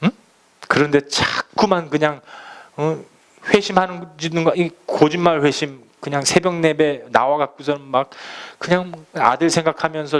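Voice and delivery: normal talking voice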